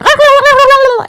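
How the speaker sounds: high, loud mimicked hen cackle; chicken cackle. Imitating the woman behind her at camp meeting, chicken cackle. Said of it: "cackling like a chicken".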